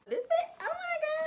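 high-pitched, loud squeal of delight; excited squeal. Real, not acted. Opening a package, squealing with excitement to viewers. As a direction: shriek it with joy. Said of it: "I'm getting so excited again".

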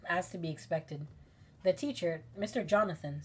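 ordinary delivery

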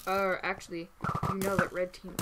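ordinary talk

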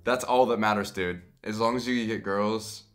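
normal talking voice

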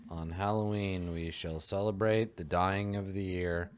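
usual speaking voice

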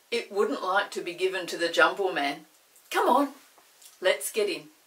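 loud, brisk Chinky voice; rally the others in; lively Chinky. Acted. Chinky urging the children into the chair, loud and brisk.

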